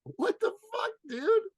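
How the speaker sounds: stunned reaction to a friend's long wait; shocked. Real, not acted. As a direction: react like you can't believe it. Shocked at two-hour wait.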